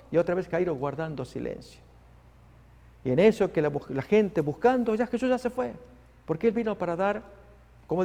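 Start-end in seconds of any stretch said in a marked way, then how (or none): none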